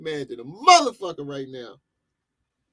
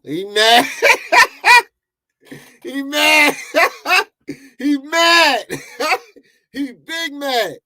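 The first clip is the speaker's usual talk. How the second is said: high, loud taunt broken by laughter; laughing taunt; 10 semitones higher, 7 dB louder, slower than usual. Real, not acted. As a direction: cackle while taunting him. Laughing mockery between laughs.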